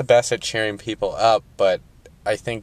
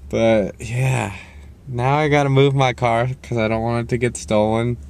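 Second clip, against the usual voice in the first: ordinary talk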